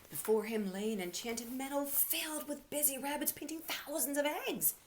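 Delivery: storyteller gasping at a wondrous sight; wonder. A narrator's reading. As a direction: reveal the magic meadow with delight. Wonder at the enchanted meadow.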